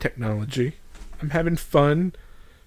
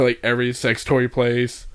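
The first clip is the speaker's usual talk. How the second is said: ordinary talk, nothing marked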